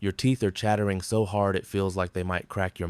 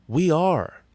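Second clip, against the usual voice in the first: loud, high group cry; shouting; 9 semitones higher, 6 dB louder than usual. A narrator's reading. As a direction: shout it back at full force. Crew answers the chant.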